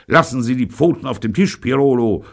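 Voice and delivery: high, forceful warning; threatening. A narrator's reading. Host warning the gangster at the table, threatening. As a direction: order him with open menace. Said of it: "sagte er drohend".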